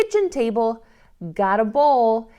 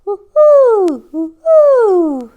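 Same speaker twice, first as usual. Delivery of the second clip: high, loud owl hoo-hoo; owl hoot; 9 semitones higher, 13 dB louder, much slower than usual. Shown as a demonstration. Teacher to children, hooting like an owl. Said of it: "An owl".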